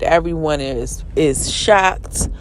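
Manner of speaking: normal talking voice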